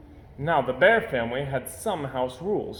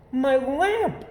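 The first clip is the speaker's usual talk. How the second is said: dismayed; 13 semitones higher, 5 dB louder than usual. Acted. Mama sees broken lamp.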